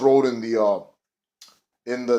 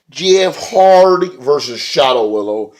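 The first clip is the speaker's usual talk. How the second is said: louder, slow ring announcer call; 3 semitones higher, 7 dB louder, slower than usual. Pretend. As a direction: draw the names out like an arena announcer. Announcing the match opener.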